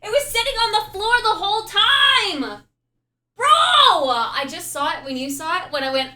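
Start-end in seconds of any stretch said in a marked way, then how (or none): 0.0-4.1 loud, high shocked yell; shouting. Real, not acted. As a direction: scream the discovery out. Shock at finding it.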